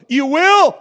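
high, loud, tart comeback; grandma's retort. Retold or imitated. Grandma answering the narrator's polite lie, high and loud. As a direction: snap it back like a sassy grandma. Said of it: "And she goes".

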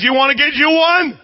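imitated high, loud shout; hollered offer. Husband offering stranger money for a beer, hollering. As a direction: holler it like the husband over the crowd.

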